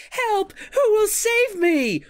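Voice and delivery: very high, loud mock plea for rescue; shrieky imitation of a bad actress; hammed-up damsel's cry. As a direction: squeal like a melodramatic damsel in distress. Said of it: "she starts out to see and she's like".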